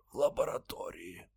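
soft, strained rasp; faint deathbed whisper. A narrator's reading. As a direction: wheeze it out dying. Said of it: "прохрипел умирающий".

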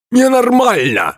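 put-on very high-pitched old man's yell; high, indignant narrated shout; outraged cry. A narrator's reading. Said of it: "закричал старик".

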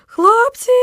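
high, loud desperate call; hopeless shriek. A narrator's reading. Trapped boy shrieking after fleeing friends, hopeless. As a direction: shriek after them in despair. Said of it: "безнадійно вириснув я".

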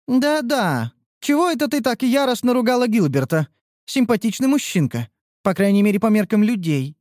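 a touch of daydreaming; dreamy. A narrator's reading. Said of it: "с толикой мечтательности".

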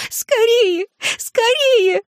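very high, frantic shrieks; sobbing scream. A narrator's reading. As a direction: shriek through sobs, desperately urgent. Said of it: "что она рыдает".

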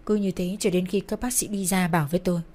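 ordinary delivery, nothing marked

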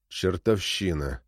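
low, slow grumble; grumbling. A narrator's reading. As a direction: grumble it under your breath. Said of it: "проворчал Титов".

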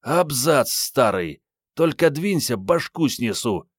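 high, angry woman's threat; husky threatening voice. A narrator's reading. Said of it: "до боли знакомый хрипловатый голос произнёс".

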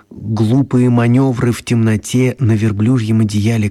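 ordinary talk

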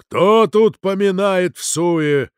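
slow, loud, chanting threat; booming priest. A narrator's reading. Priest threatens whoever swears in vain, booming. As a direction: thunder it out with stretched vowels. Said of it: "пробасил отец Нафанаил".

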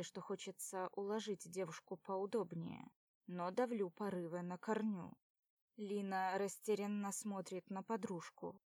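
ordinary delivery

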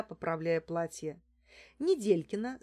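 ordinary delivery

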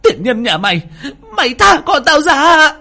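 high, loud enraged screech; furious shriek. Acted. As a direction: screech to make the attacker let go. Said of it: "Mụ rít lên một tiếng".

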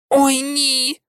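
high, drawn-out cry of dismay; dismayed exclamation. Acted. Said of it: "вигукнула Герміона". (вигук розпачу)

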